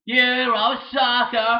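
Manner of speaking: loud, drawn-out insult; loud slow taunt. Acted. Taunting the rival, a loud slow shout.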